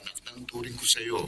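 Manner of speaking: faint, distant voice through a phone line; over the phone. Acted. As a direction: sound like you're on the far end of a call. Uncle speaking over the phone.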